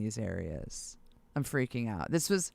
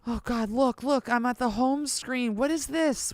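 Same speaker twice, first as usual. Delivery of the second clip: excited; 8 semitones higher, 5 dB louder than usual. Real, not acted. Browsing gear listings, startled.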